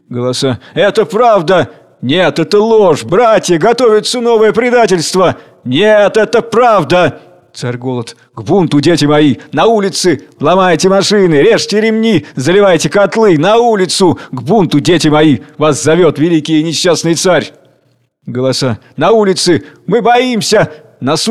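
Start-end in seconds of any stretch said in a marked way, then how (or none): 0.7-7.2 high, heated shouts back and forth; arguing crowd voices. Acted. Crowd argues. 8.3-17.5 high, loud rousing shouts; call to riot. Acted. Tsar calls to riot.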